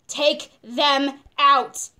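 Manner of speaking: "Take them out" word by word, slow and loud; insistent demand. Retold or imitated. Insists again to the doctor.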